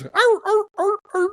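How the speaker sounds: very high-pitched mock "arr" yelps; imitated barking noise. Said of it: "She goes".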